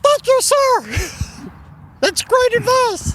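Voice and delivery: squeaky eager underling. Acted. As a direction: gush your thanks like a squeaky yes-man. Eager subordinate, laughing.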